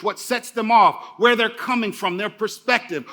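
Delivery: ordinary talk, nothing marked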